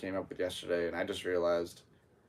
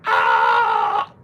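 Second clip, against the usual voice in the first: sudden high yelping scream, much higher and louder; mock scream of shock; 11 semitones higher, 14 dB louder than usual. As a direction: let out a hammed-up pained shriek. Guy in vacuum clip screams.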